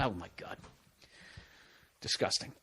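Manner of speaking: whispered in disgust. Real, not acted. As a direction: breathe it out, appalled. Disgust at Stephen A.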